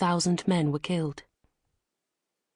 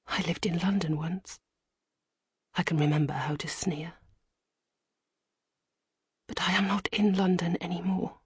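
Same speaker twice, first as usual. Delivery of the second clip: hushed, breathy storytelling whisper; whispered narration; 4 dB quieter than usual. A narrator's reading. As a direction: tell it under your breath, like a secret.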